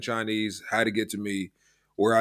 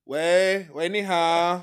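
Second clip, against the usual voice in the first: mock Chinese phone call; 7 semitones higher, 4 dB louder, much slower than usual. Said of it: "how Chinese phone conversation goes".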